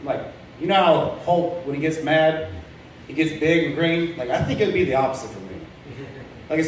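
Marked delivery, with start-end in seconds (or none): none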